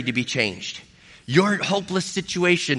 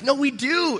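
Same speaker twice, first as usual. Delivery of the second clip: higher, quick insistent reply; eager reassurance; 8 semitones higher than usual. Retold or imitated. Pastor insisting to the doubtful attendant that she is welcome, pitched up. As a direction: jump in high to insist she is wanted. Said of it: "And I was like".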